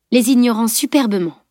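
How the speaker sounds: ordinary delivery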